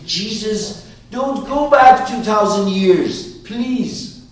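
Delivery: high, loud plea not to look back; pleading call. Acted. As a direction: beg them to stay in the present. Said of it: "Means when I say".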